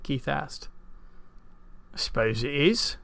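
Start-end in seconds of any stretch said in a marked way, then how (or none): 1.9-3.0 put-on mildly grudging old farmer voice; admitting farmer. A narrator's reading. Farmer conceding to Keith, admitting it. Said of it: "the farmer admitted".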